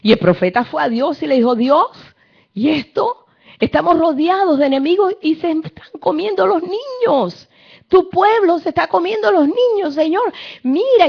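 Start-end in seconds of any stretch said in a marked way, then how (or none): 1.6-11.1 high, louder anguished plea; crying out to God. Acted. The prophet crying out to God, high anguished plea. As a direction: beg heaven through tears. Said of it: "empezó a clamar a Dios y llorando".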